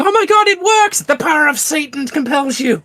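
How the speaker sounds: screaming high and loud; mad scientist. Pretend. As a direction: maniac screaming in triumph. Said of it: "I am cackling maniacally and screaming".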